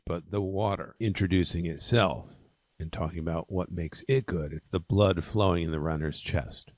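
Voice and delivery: ordinary delivery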